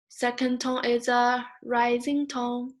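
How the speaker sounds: "second tone is a rising tone" chanted high, lifting at the end; tone song, rising. Shown as a demonstration. Teacher recalling the tone song, rising chant. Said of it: "you still remember the song".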